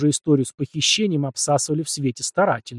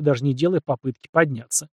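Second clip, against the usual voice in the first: ordinary delivery, nothing marked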